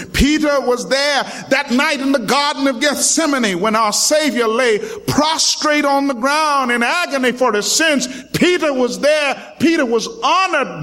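preacher building the congregation to a shout; preaching build. Acted. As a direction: climb to the cry of Peter was there.

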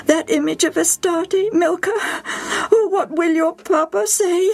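high, crying mother's lament; tearful. A narrator's reading. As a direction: stammer and wail through acted tears. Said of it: "Evie burst into tears".

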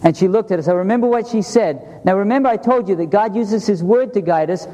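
ordinary talk, nothing marked

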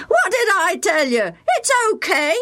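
high, triumphant call from far side; boy shouting across. A narrator's reading. Bill calling back across the pond, high and triumphant. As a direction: crow that you were right. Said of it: "he shouted".

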